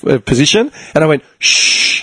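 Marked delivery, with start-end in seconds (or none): 1.4-2.0 long unvoiced shush; hushing. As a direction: shush the boys mid-celebration. Hushing his celebrating teammates.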